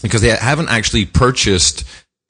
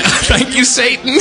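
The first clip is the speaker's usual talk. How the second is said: laughing; slower than usual. Real, not acted. Laughing repeating the clip.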